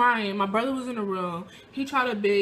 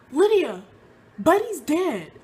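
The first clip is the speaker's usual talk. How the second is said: urgent, raised voice as a retold brother; urgent news; 7 semitones higher, 6 dB louder than usual. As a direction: call out that the dog is dead.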